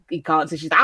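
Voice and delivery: ordinary talk, nothing marked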